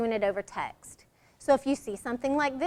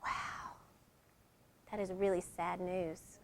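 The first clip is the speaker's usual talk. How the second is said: hushed, much softer sad news; whispered sad wow; 6 semitones lower, 14 dB quieter than usual. Shown as a demonstration. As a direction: breathe it out in sympathy.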